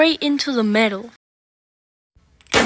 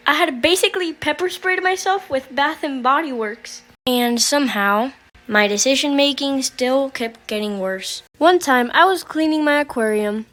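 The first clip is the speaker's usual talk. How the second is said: ordinary talk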